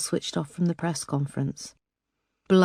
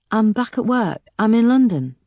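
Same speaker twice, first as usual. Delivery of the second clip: forced brightness; 6 semitones higher, 5 dB louder than usual. A narrator's reading. Erica deflects brightly.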